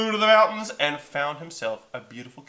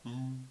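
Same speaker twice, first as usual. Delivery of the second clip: quiet electric hum; 13 dB quieter than usual. Retold or imitated. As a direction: imitate a nearly silent electric engine. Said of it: "it was more like".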